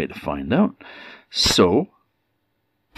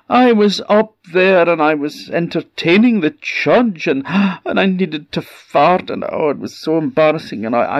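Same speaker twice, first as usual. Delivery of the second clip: high, flustered embarrassed woman voice; a lost woman babbling her embarrassment; lost woman begs a stranger for help, high and flustered; 8 semitones higher than usual. Acted.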